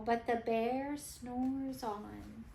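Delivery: slow and soft, drifting off; lullaby hush; hushed refrain. A narrator's reading. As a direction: let the line fall asleep. Sleepy refrain, narrator hushes.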